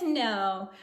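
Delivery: usual speaking voice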